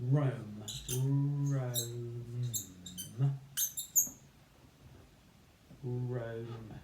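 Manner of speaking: Rome drawn out slowly; stretched syllable. Shown as a demonstration. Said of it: "for the J in Jerome".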